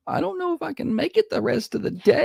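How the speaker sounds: high, whiny mocking of the wife; husband mimicking wife's tired phone call, high and whiny. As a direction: whine it like she's fading.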